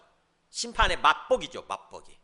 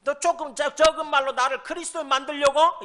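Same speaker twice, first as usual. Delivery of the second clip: high, loud incredulous question; acted jeering king, pitched way up; mock scornful retort; 9 semitones higher, 6 dB louder than usual. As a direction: scoff at the preacher's attempt. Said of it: "이 욕이에요".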